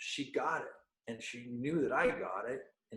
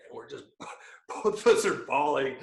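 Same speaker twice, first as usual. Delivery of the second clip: ordinary talk, nothing marked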